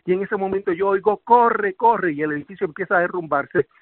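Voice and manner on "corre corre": high-pitched imitated warning; retold urgent call. Someone warns survivor of the collapse, retold urgent shout.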